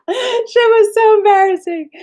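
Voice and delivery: high, slow laugh-cry. Real, not acted. Cringing at her own Twitter blunder, laughing and crying. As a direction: laugh it out, wincing at yourself.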